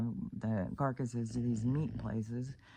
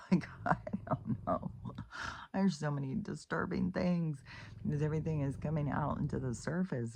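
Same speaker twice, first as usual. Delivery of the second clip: ordinary talk, nothing marked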